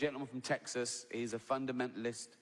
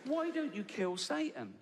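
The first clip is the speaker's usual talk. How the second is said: higher, pressing, insistent; challenging God, higher and pressing; indignant high question; 8 semitones higher than usual. Retold or imitated. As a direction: push the question hard. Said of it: "Then I'd say".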